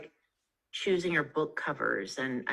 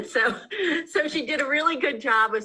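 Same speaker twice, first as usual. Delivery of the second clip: talking through a laugh, pitched up; 9 semitones higher, 3 dB louder than usual. Real, not acted. To the host after her Boston joke, laughing while talking. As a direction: let the laugh spill into the words.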